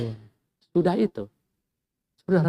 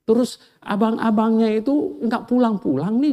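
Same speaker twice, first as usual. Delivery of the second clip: normal talking voice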